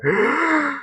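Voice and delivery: sharp imitated gasp, high and breathy; a hammed-up gasp of awe; mock gasp. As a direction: gasp like he saw something shocking. Friend ogling waitresses.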